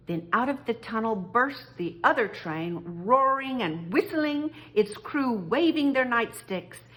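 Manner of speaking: ordinary delivery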